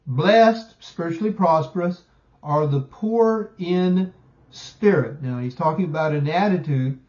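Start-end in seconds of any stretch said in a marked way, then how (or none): 0.1-5.2 an emphatic beatitude, slowed down; emphatic quote. Retold or imitated. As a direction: proclaim it slowly, leaning on Blessed. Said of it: "very emphatic".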